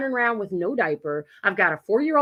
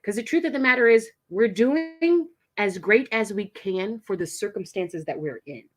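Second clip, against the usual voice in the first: ordinary talk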